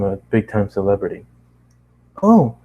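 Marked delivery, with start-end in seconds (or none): 2.2-2.6 sudden remembering. Acted.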